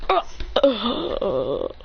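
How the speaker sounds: acted grunt, then drawn-out groan; pained groan. Character stabbed in the back, pained groan. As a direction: groan as if struck from behind.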